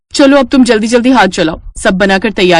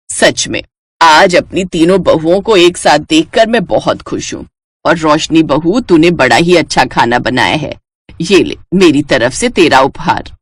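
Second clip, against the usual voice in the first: put-on low, pleased elderly mother-in-law; proud old matriarch handing out gifts; 4 semitones lower than usual. Mother-in-law praising her new bahu, low and pleased.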